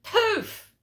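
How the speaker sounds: high, loud mock-shocked "Oh, poof"; a hammed-up, outraged old mum; shocked gasping exclamation. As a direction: squeal it like mum scandalised on the phone. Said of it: "she said".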